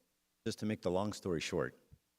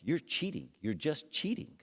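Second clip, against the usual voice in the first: mildly indignant, pitched up; higher-pitched protest, lightly acted; upset accusation; 7 semitones higher than usual. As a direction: retell an exasperated dad accusing his son. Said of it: "I was so upset".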